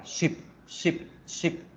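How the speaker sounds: "ship" with a quick, relaxed i; short i. Shown as a demonstration. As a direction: clip the vowel in each "ship".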